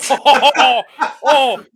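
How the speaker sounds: loud, high, laughing "oh" of pretend offence; hammed-up affronted exclamation; mock outrage. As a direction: gasp as if scandalised, half laughing. Mock offence at Sturgeon comparison.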